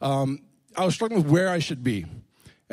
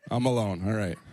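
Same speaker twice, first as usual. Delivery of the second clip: mock dejected; 7 semitones lower than usual. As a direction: play the lonely alumnus, deflated. No one cheers for his school.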